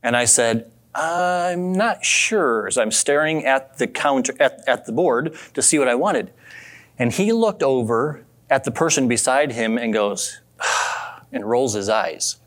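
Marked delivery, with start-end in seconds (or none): 0.9-2.7 long, drawn-out unsure reply, pitched higher; hesitant. Retold or imitated. Customer stalling at the counter, slow, drawn-out hesitation. 10.6-11.1 imitated huffy, voiceless sigh; exasperated sigh. Server fed up with a slow customer, mock exasperated sigh. Annoyed server rolls his eyes.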